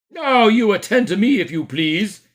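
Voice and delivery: high, loud officious demand; policeman. Acted. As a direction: call him to order.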